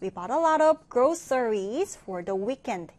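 very slow, clear reading of the English sentence; slow, careful classroom read-aloud; slow model reading. Shown as a demonstration. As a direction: read it slowly for learners to follow. Teacher reads model sentence.

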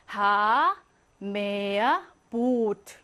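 하, 메야, 보트 each with a drawn-out vowel; long vowels. Shown as a demonstration. Teacher showing double vowels, long held 하, 메야, 보트. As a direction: hold the vowels in 하, 메야, 보트, pausing between words. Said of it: "길게 장음으로 읽어야".

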